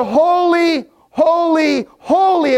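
high, ringing worship chant; angels' song of praise. Acted. Preacher voices angels singing to God, high and exultant. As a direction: lift and hold each repeated word. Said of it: "they sing".

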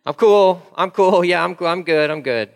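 loud, forced breezy brush-off; fake fine. Churchgoer hiding a crumbling life, forced cheer.

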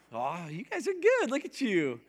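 ordinary talk, nothing marked

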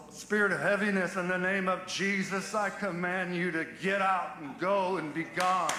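high, ringing rebuke and praise; fiery preaching prayer. Acted. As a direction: cry out commands and glory to God. Prays at full force.

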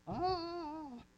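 sudden high cry of falling; falling scream. Acted. Joseph thrown down the well, high falling cry. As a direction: yelp as if dropping into a pit.

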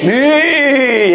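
high, long-held "Me"; drawn-out, rallying "Me"; emphasis on ME. Shown as a demonstration. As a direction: belt "Me" out high and stretch it. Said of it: "put the emphasis on the me".

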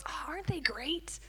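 breathy marvelling whisper; awed whisper. Retold or imitated. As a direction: whisper it as if not to disturb. Heavenly Father marvelling at us.

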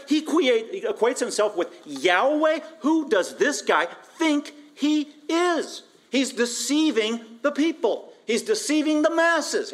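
high, indignant mock outrage; incensed. Preacher voices scribes and Pharisees accusing Jesus, incensed. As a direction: act out scandalised fury, pitched high and emphatic. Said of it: "they are completely incensed".

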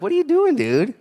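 mock God calling out a lazy witness; the Lord scolding. God asks the preacher what he's doing, incredulous. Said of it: "the Lord's like".

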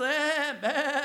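two drawn-out mock bleats; imitated sheep bleat. Imitates sheep during shepherd work.

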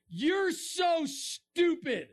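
high, drawn-out jeer; imitating a scornful online commenter; mock insult.

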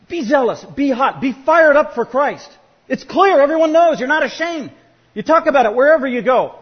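loud, high preaching at full force; rally them to be on fire; preacher urging the church to zeal, loud and fervent; fired-up exhortation. Acted.

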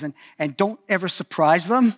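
ordinary talk, nothing marked

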